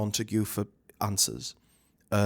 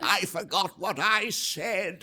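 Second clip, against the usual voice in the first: put-on slow, high doddery old admiral; very slow, higher old-man voice with nothing left to give; decrepit old man; 8 semitones higher, much slower than usual. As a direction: drag it out like a man on the brink of death. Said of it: "decrepit old man voice that comes out".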